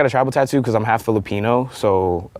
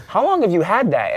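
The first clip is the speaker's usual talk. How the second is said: grandma voice; 10 semitones higher than usual. Retold or imitated.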